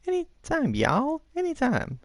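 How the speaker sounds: very high-pitched squirrel quiz host; squeaky little squirrel character; squirrely voice. Acted. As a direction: read it out like an excitable squirrel. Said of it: "That was my squirrely voice".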